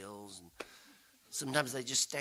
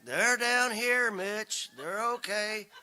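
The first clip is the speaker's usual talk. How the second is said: slow, higher call from a distance; hollering back; 7 semitones higher, 5 dB louder, slower than usual. Retold or imitated. Sister hollering back up the road to Mitch, slow and high. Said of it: "my sister hollers back".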